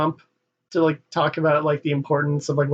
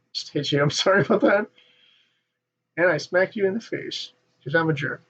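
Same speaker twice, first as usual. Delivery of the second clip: ordinary delivery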